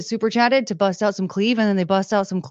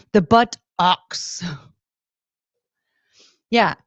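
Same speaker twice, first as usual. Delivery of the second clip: usual speaking voice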